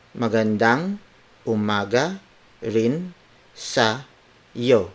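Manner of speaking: slow, every word on its own; careful dictation with pauses between words. Shown as a demonstration. Teacher breaking down good morning to you, word for word. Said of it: "word for word".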